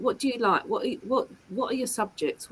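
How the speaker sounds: usual speaking voice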